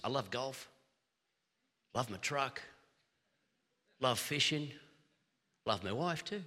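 lightly voiced low, soft colleague impression; a low, understated list of loves with pauses; Pastor Steve. Preacher teasing a travelling companion's loves, lightly mimicked. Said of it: "You travel with Pastor Steve".

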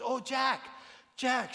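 high, bright call of his name, retold; a friend thrilled to spot him calling his name; excited calling out. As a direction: call out to him, happily surprised. She spots him and calls out.

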